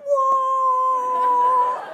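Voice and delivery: very high, loud, long-held "whoa"; loud group whoa. Retold or imitated. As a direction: imitate a whole crowd answering in one sustained cry. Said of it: "And they're like".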